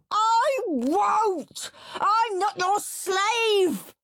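high, slow, loud tantrum; child throwing a tantrum; tantrum scream. Acted. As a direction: scream it while kicking on the sofa. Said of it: "kicking and screaming on the sofa".

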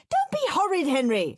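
high-pitched screamed scolding. A narrator's reading. Mum telling Henry off, screamed. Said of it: "screamed Mum".

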